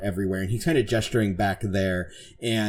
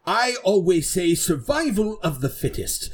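higher-pitched, proud swagger; boastful knight; 8 semitones higher than usual. Acted. Knight challenging the ship to match them, boastful.

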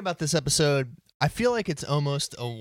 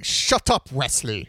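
Jean-Luc Picard snapping; 2 semitones lower, 3 dB louder, slower than usual. Retold or imitated. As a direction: bark it at the kid like an irritated captain. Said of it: "Jean-Luc saying".

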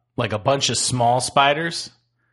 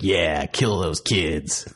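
mock sinister voice; 6 semitones lower, much slower than usual. Mock sinister line about movie.

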